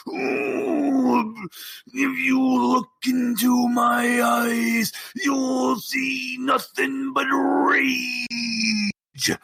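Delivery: slow raging barbarian shout. Acted. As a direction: bellow it high and slow with rage. Champion roars his threat.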